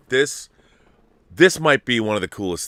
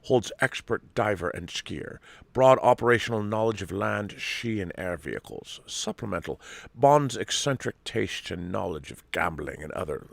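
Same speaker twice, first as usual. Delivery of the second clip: badly faked Scottish accent; hammy, slightly hushed Scottish Bond voice; Sean Connery; 5 dB quieter than usual. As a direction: read the spy dossier in a mock Scottish accent. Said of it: "this is a terrible accent".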